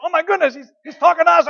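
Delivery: high-pitched, loud thrilled gasp; breathless disbelief, high and loud; excited squeal. Retold or imitated. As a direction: squeal like you can't believe it. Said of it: "Debbie was saying".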